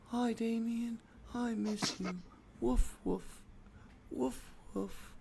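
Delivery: high, soft puppet voice for a dead dog; mouth puppet dog voice. Pretend. Podcast host voices the dead dog as a puppet, high and soft. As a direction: squeak it out like a sock puppet pleading with its owner. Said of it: "little mouth puppets going".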